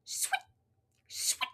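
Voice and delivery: very soft murmur; soft sweet. Acted. The story's quiet sound, very soft. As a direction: barely breathe it.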